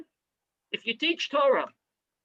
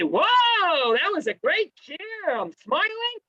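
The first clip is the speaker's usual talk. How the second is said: mock delight; 7 semitones higher, slower than usual. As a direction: play a student gushing over a great class. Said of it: "you say".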